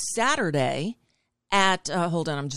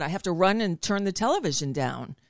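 ordinary delivery

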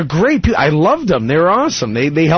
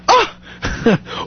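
high-pitched mimicked cry of shock; shocked gasp; 13 semitones higher than usual. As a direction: imitate a scandalized "Ah!". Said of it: "they make the same noise too".

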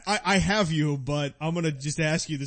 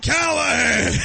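louder, higher bark of "Callahan!"; shouting chief; 6 semitones higher, 7 dB louder, slower than usual. Pretend. Police chief calling his detective in, loud shout. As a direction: yell across the office for the detective. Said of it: "This is my chief".

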